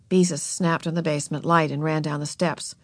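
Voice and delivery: ordinary delivery, nothing marked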